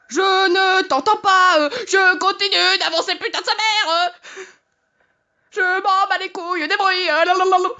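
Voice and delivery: blot out the scary noise with your voice; sing-song shouting over fear; defiant drowning out. Real, not acted.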